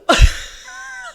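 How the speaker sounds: high, loud laugh; real high-pitched laugh; burst of laughter. Real, not acted. Laughs at not knowing the slang.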